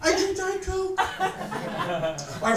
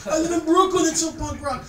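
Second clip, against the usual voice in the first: snooty hipster brag, higher and louder; mock hipster boast; 6 semitones higher, 4 dB louder than usual. Comic mocking Brooklyn hipsters to the crowd, smug and snooty.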